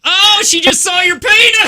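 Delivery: high hammed-up shocked yell; mock shock. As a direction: cry out in fake alarm.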